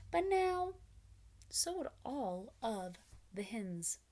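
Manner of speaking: usual speaking voice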